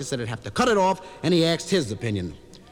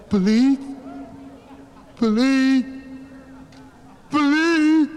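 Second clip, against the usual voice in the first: put-on high, drawn-out kid's cry for help, climbing; hammed-up puny yell; feeble yell; 8 semitones higher, slower than usual. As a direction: yell for help as a sobbing child would. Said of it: "Let me hear you yell".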